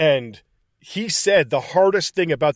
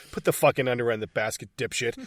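deadpan order; 5 semitones lower, 6 dB quieter, faster than usual. Retold or imitated. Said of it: "stonewall stares him".

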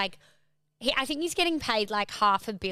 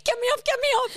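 high mock-frantic plea; imitated panicked rider; 13 semitones higher, 4 dB louder, faster than usual. Daughter goes horse riding, mock panicked pleading. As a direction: squeal to be rescued, hammed up. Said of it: "she's like".

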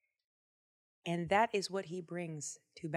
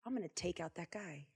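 quiet resolve; 3 semitones lower, 11 dB quieter than usual. Acted. David voiced quietly.

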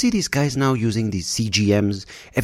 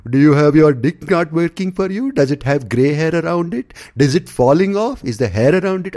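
higher, louder sleazy pitchman; that ad guy voice; 9 semitones higher, 5 dB louder than usual. Retold or imitated. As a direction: ham up the cringe ad guy asking about his dick. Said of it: "Don't get that guy going".

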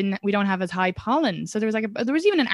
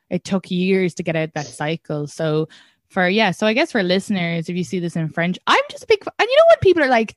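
usual speaking voice

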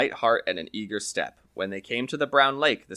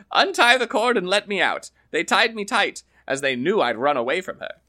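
lively higher voices for Donald and the farmer, trading lines; storyteller's character voices; 6 semitones higher, 4 dB louder than usual. Acted. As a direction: play the Irish tale's quarrelling characters with a raised voice. Donald inside the bag.

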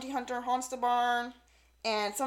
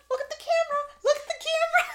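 much higher, sing-song coaxing; coaxing baby talk; 5 dB louder, faster than usual. Retold or imitated. Sam coaxing the puppy for a selfie, high baby-talk coaxing. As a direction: coax a puppy to look at the camera.

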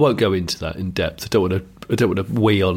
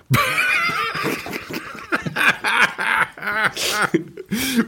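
long high-pitched giggle; real giggling fit at a silly name; 14 semitones higher than usual. Real, not acted. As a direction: collapse into helpless giggles. Laughing at the joke name.

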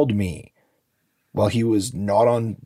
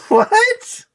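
even higher squeal of outrage; shocked disbelief; 6 dB louder than usual. Real, not acted. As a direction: shriek it in disbelief. Shocked reaction to the case.

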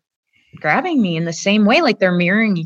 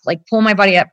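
ordinary delivery

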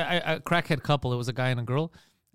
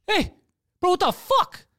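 high, loud retold call-out; indignant shout in a retelling; angry confrontation; 14 semitones higher, 5 dB louder, slower than usual. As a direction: holler it at the driver, reenacting.

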